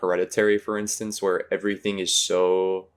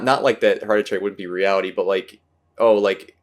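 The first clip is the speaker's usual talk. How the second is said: normal talking voice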